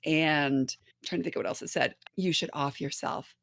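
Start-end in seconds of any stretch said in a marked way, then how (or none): 2.2-3.3 quiet, low, coaxing menace; soft sinister voice. Retold or imitated. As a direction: murmur it softly, like a tempter. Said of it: "soft and".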